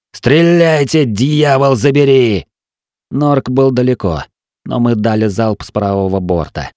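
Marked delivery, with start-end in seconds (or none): 0.1-2.5 high, angry battle order; shouted command. A narrator's reading. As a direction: curse and urge the gunners on. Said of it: "закричал я".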